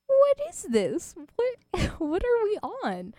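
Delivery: high, slow disbelieving squeal; baffled high. Real, not acted. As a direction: sound utterly bewildered. Baffled at the clip.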